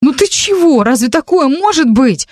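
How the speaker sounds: high-pitched defensive protest; talking her way out. A narrator's reading. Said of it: "пыталась отбрехаться Маринка". (оправдываться, отнекиваться)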